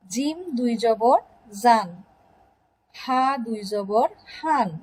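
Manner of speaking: slow letter-by-letter recitation, pausing before আন, বান, তান; measured teaching chant of আন, বান, তান, সান, জান, হান; pronunciation drill. Shown as a demonstration. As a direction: spell it out slowly, then land on the syllable, as in আলিফ দুই জবর, আন. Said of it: "মনোযোগ সহকারে উচ্চারণগুলো শোনো".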